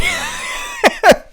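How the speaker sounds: very high, loud giggle; gleeful high-pitched cackle; laughing. Real, not acted. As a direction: burst out laughing at your own prank. Laughs at own prank memory.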